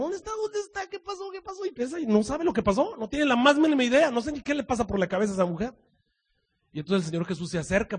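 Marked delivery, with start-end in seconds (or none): 0.0-1.6 high, hushed frantic woman's voice; hysterical Mary. Acted. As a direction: voice Mary frantic at the empty tomb. Mary voiced searching the tomb. (María angustiada, aguda y en voz baja)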